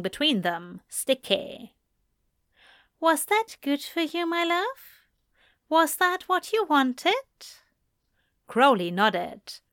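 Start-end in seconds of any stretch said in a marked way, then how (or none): 3.0-7.3 high, caring lover's question; Aziraphale, tender. A narrator's reading. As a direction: check on him gently and lovingly.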